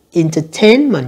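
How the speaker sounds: Entertainment, third syllable stressed. Shown as a demonstration. Teacher showing students the stress, third-syllable stress on Entertainment. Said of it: "nhấn âm thứ ba".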